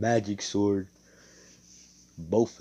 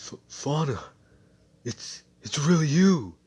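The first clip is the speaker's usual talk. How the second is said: stunned disbelief; 7 semitones higher, 6 dB louder than usual. Acted. Mars finds friend she thought dead.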